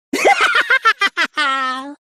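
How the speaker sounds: loud, very high doll cackle; long, cold puppet laughter. A narrator's reading. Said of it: "let out its cold laugh".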